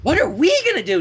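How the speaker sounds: high, bubbly, excited squeal; excited Pinkie. Acted. Pinkie grinning at Twilight, bubbling with excitement. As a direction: bounce with excitement. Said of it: "big excited grin".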